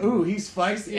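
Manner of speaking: high, loud, sassy put-on voice; sassy tease. Teasing the angry waiter, sassy mock voice. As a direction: camp it up with a hair flip. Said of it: "I gave him the, her hair".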